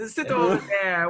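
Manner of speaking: bad fake Spanish accent. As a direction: bark it in a hammy Spanish accent. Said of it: "worst Spanish accent I've ever heard".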